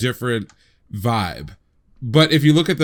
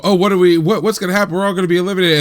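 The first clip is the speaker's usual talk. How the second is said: high, louder mock panic; mock worried fretting; 7 semitones higher, 6 dB louder, much faster than usual. Imagined viewer fearing everyone will be eliminated, mock worried. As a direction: fret in mild, hammed-up alarm.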